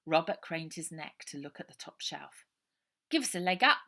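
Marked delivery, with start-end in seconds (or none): 3.1-3.8 put-on high, loud boy yelling get off; an irritated kid shoving someone away; cross young boy. A narrator's reading. Robert's voice.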